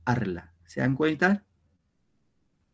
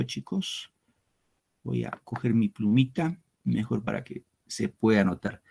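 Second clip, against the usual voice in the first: ordinary talk, nothing marked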